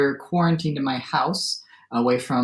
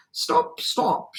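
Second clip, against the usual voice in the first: higher-pitched, half-voiced call to halt; mild shout; 10 semitones higher than usual. A narrator's reading. Armadillo tries to halt the cowboy, mild shout. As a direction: call stop without full volume. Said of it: "shouted the armadillo".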